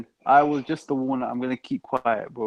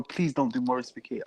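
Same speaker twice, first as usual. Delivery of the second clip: much softer, pleading under the breath; quiet plea; 2 semitones lower, 9 dB quieter than usual. Real, not acted. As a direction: beg him quietly to stop. Begging friend not to.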